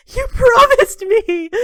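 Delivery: high, loud, slow wail. Acted. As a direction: wail it out in betrayed anguish. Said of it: "she wails".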